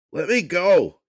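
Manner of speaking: high, loud desperate yell; panicked shout. Acted. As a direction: scream to be freed. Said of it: "he shouted".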